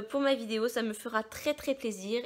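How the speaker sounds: ordinary talk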